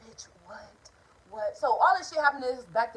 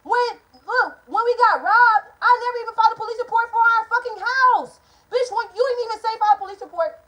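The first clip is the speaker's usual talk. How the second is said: getting mad; 10 semitones higher, 11 dB louder than usual. Real, not acted. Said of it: "Ooh, I'm spitting. I'm make, it's making me mad".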